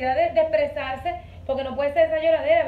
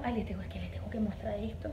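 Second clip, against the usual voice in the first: soft unvoiced whisper to viewers; 4 semitones lower, 7 dB quieter than usual. Real, not acted. Vlogger whispering to her viewers beside the baby.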